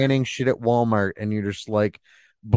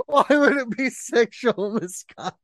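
after laughing hard; 10 semitones higher than usual. Real, not acted. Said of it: "my stomach hurts from laughing".

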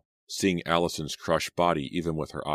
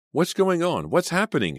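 high, loud demanding cry; 13 semitones higher, 5 dB louder than usual. A narrator's reading. Girl pushing through the crowd demands answers, high and loud. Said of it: "she demanded".

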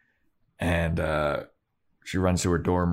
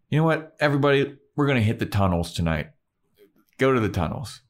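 mock villain boss giving orders; rally the minions, higher at first; bossy ordering; 6 semitones higher, faster than usual. Sunday ordering her little minions around, mock bossy. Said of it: "She's ordering her little minions around".

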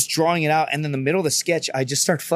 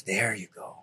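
low, soft, satisfied concession; quiet approval; 7 semitones lower, 10 dB quieter, slower than usual. Retold or imitated. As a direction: drop right down into a hushed acknowledgment. Imitates gunny approving the answer.

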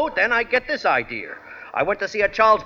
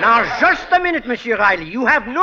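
put-on indignant French-accented protest; 4 semitones higher, 5 dB louder than usual. Phony Frenchman protests in acted quarrel.

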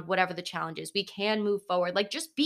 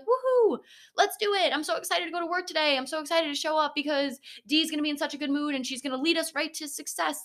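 high-pitched imitation of an overeager team; mock enthusiasm; 8 semitones higher than usual. Imagined team cheering on a negative leader, mock excited. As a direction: cheer with exaggerated, sarcastic eagerness, much higher than usual.

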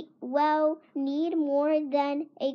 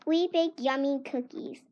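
usual speaking voice

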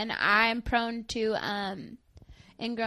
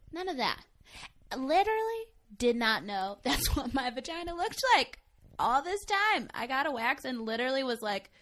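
normal talking voice

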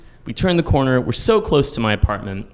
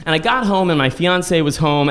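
normal talking voice

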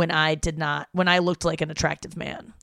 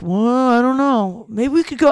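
parents voice; 6 semitones higher, 8 dB louder than usual. Retold or imitated. Parents hesitating about hunting morels, in a higher mimicking voice. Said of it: "they're just like".

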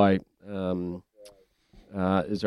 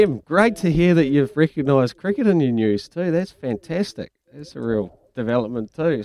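ordinary delivery

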